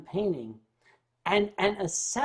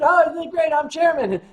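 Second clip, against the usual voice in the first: higher and louder, hammed-up delight; playful fake cheer at the news; mock excited boast; 8 semitones higher, 8 dB louder than usual. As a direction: crow about it like a proud kid. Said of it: "I got home and said".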